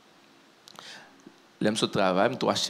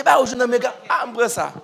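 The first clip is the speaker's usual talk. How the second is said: excited quote; 10 semitones higher, 7 dB louder than usual. Retold or imitated. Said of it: "il dit".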